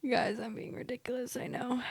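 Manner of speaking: hushed, breath-only confession; sheepish whisper. Real, not acted. Vlogger admitting to viewers she's being ridiculous, sheepish whisper. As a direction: whisper it after a laugh.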